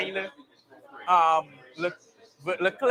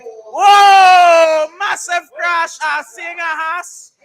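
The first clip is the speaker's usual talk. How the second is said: high, loud shocked shout; real shock; 9 semitones higher, 13 dB louder, much slower than usual. Real, not acted. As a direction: yell out at the sudden crash. Sees a crash live.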